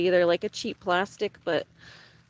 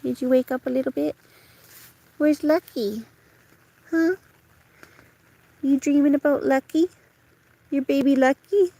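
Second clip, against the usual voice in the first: high, louder sing-song coo to a pet; 5 semitones higher, 3 dB louder than usual. Real, not acted. Cooing at her waking dog about its kitten, high baby talk.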